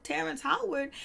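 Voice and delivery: high, disbelieving echo of the name; stunned double take on Terrence Howard; incredulous. Retold or imitated. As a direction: repeat the name as if you can't believe it. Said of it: "I was like".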